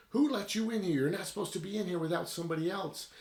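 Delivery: high, hurried scolding of an intruder; startled reprimand. Retold or imitated. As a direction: snap at the girl in alarm, fast and high. Staff member bursts in, scolding.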